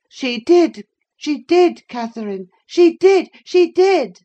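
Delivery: put-on high, loud boy's chant, rising in pitch; insistent taunting. A narrator's reading. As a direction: repeat it to goad your cousin. Boy repeats taunt at Catherine.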